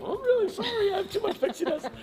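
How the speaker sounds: very high, silly apologetic voice; giggly high-pitched put-on voice; playful mock apology. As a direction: squeak out a pretend apology, half laughing. Mock apology for throwing sparks.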